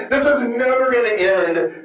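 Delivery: ordinary delivery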